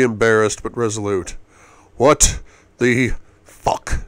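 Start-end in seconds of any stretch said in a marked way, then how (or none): 2.0-4.0 loud, sharp, exasperated; angry outburst. A narrator's reading. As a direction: snap it out in frustration. Interrupted kiss, outburst.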